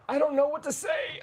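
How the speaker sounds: high, squeaky panicked voice; panicked squeak. Retold or imitated. Retold panic on podcast.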